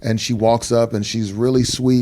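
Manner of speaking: ordinary talk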